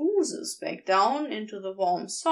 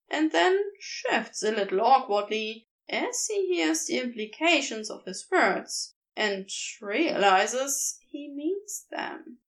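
plain reading voice

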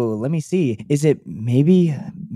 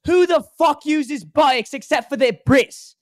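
British inner monologue; 10 semitones higher than usual. Acted. Playing an actor's inner monologue, high British accent. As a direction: draw it out in a squeaky British accent. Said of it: "maybe his inner monologue is".

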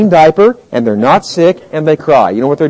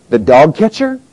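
ordinary talk, nothing marked

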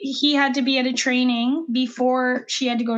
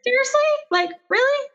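exasperated; 12 semitones higher, faster than usual. Real, not acted. Exasperated disbelief at nap waking.

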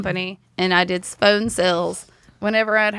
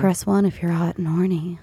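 low, sultry hotline voice; phone sex line voice; 4 semitones lower than usual. Pretend. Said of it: "I can do voiceovers as well".

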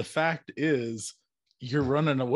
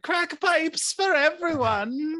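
mock child catcher. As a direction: dangle the treat like the child catcher. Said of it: "I always go to the child catcher".

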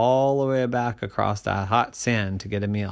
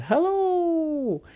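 high squeaky little fish. Acted. Same fish greets again.

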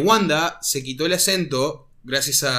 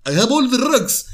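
higher, exaggerated Eastern European rugs; heavy Sokovian accent; 7 semitones higher, 3 dB louder than usual. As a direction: lay the accent on thick. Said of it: "de Age of Ultron".

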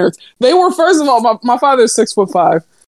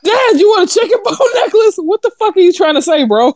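laughing retelling; 10 semitones higher than usual. Retold or imitated. Retelling the kid's line laughing.

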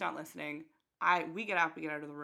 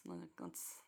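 hushed aside, softer; whisper; 12 semitones lower, 15 dB quieter than usual. Real, not acted. Clarifying to her friend in a whisper. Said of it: "whispered aside".

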